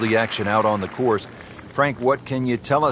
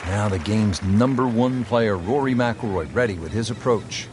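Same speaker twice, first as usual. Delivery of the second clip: golf commentator. Acted. Game commentary play-by-play.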